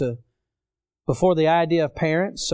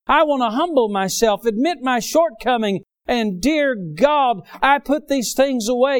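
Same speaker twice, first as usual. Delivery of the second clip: impassioned preaching, higher pitch, firm push; high-pitched, driving sermon delivery; 6 semitones higher, 3 dB louder than usual. Real, not acted. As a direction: preach it high and forceful to the congregation.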